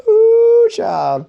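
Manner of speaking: very high, loud squealing ooh; a campy squeal at himself; sassy exclamation. Real, not acted. Laughing at himself being a baller, a loud high squeal. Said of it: "self-mocking exclamation".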